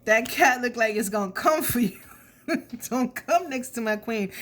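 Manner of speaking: giggling through the words; laughing. Real, not acted. Reader laughing about the cat guarding the queen. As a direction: chuckle while warning them off.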